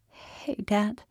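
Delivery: small, cracking daughter's greeting; a slight crackle in a young woman's hello; crackling voice. A narrator's reading. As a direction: say it as if the voice might crack. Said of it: "her voice crackled".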